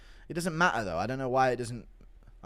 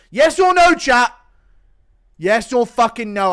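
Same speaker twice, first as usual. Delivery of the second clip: much higher, much louder yell at chat; demanding shout; 9 semitones higher, 14 dB louder than usual. Real, not acted.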